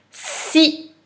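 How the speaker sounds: Si pitched high, with a held s; dictated syllable. Shown as a demonstration. Teacher dictating a syllable to pupil, high, careful Si. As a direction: call out Si high and distinctly.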